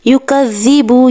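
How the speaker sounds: یکذبو with a soft dh, slowly; soft dhal. Shown as a demonstration. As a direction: say یکذبو with gentle dhal, not z. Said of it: "ذال کی آواز نرمی کے ساتھ". (یکذبو میں ذال نرمی سے)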